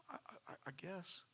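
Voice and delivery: stammering, whispered, unsure; hesitant whisper. Retold or imitated. Crying woman at the counter answering Dina, whispered. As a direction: barely breathe out a hesitant yes. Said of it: "She goes".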